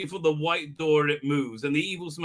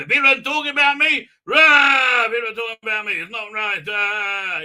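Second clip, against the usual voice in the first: high, loud mock tantrum with roars; mock angry rant; 6 semitones higher, 7 dB louder than usual. Reviewer acting out a man getting mad, hammed-up shouting. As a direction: imitate a man ranting in outrage. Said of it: "He gets mad and mad".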